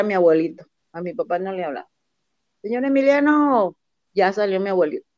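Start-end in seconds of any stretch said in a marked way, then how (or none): 2.6-3.8 called out high at the door; calling out. Retold or imitated. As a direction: call out to him from outside.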